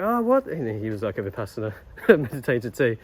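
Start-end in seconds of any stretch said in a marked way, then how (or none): 0.0-0.5 much higher, louder surprise; surprised old man. Retold or imitated. Old man amazed by the meditation news, high surprised imitation. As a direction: gasp it out in his voice.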